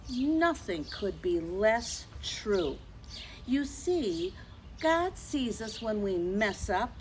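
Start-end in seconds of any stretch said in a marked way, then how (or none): none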